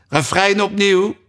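loud, high call. A narrator's reading. Gryphon calls to Mock Turtle for the chorus again, loud. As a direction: shout it out eagerly. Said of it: "riep de griffioen".

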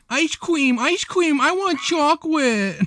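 put-on high whiny kid yelling; excited toddler. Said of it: "jumped up and down yelling".

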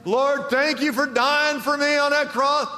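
high, loud, driving sermon voice; full-force pulpit retelling; fiery preaching. Acted. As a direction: preach it hard, climbing with every line. Closing exhortation at full force.

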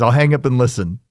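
ordinary talk

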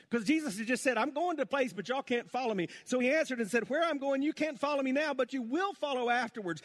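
fired-up preaching. Acted. Preacher recounting Jesus and Peter to the church, fired-up preaching. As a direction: drive the story home to the crowd.

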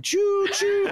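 high loud choo choo whistle; chugging toy train voice. Pretend. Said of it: "going around going".

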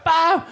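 loud high boom sound; loud mimicked boom; wave crash noise. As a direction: burst out with the impact. Imitating wave impact.

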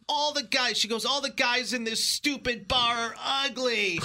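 put-on high, loud, tearful drunken yell; mock drunk screaming at the bartender; drunk girl screaming. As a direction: wail it like a sloppy drunk girl. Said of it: "she starts screaming".

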